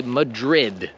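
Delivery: Madrid with a hard, clear D at the end; English Madrid, final D released; strong final D. Shown as a demonstration. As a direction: land the D in Madrid firmly. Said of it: "we pronounce the D very strongly".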